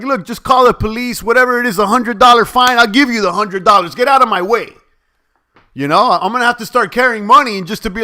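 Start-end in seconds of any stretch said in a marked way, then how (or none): 0.0-4.7 higher and louder, defiant comeback; louder imagined telling-off of the cashier; imagined loud retort. Retold or imitated. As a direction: tell her off as if she's right there.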